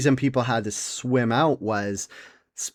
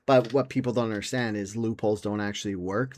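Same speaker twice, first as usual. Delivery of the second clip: ordinary talk, nothing marked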